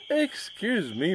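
lightly voiced refined gentleman, slow and high; polite upper-class interruption; well-bred voice. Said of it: "said a well-bred voice".

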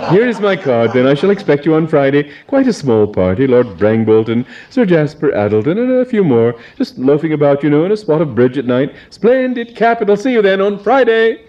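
high, bright-toned gushing toff; hearty baronet. Acted. Baronet inviting Adrian, high gushing toff voice.

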